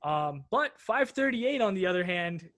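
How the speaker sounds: usual speaking voice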